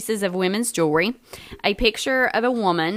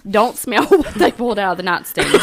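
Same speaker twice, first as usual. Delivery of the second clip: usual speaking voice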